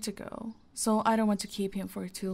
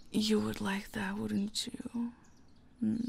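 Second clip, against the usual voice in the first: whisper. Acted. Teasing the guest with a whispered question.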